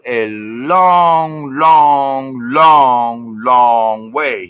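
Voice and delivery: each "long" stretched slow and loud; "long" held over and over, heavy and slow; drawn-out emphasis on LONG. Retold or imitated. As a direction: drag out every "long" to show the endless distance. Stretching out the endless drive.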